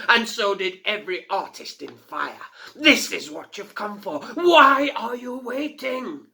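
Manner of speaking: mocking fire fiend. A narrator's reading. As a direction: sneer down at the tiny girl. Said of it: "his voice rang out clearly".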